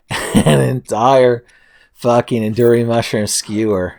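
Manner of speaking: ordinary talk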